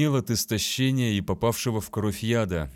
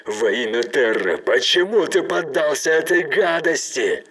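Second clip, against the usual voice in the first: loud, higher, pleading shout; desperate shout; 6 semitones higher, 7 dB louder than usual. Acted. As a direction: beg for a reason, shouting.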